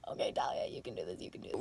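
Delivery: hushed whisper to herself; a tense whisper, talking herself into it; whispered self-pep talk. Retold or imitated. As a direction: whisper it to yourself, bracing for the needle. Psyching herself up before needle.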